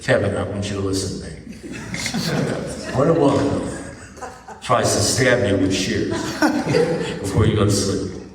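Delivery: low, deliberate counsel with long pauses; grave advice. Retold or imitated. Sponsor-like friend warning Kevin about Lucy, grave and deliberate.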